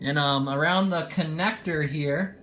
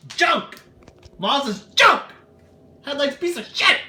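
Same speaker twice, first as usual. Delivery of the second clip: high, loud frustrated yelling; sudden loud outburst; 10 semitones higher, 7 dB louder than usual. Real, not acted. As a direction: blow up at the junk headlight. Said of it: "sorry for the little outburst there".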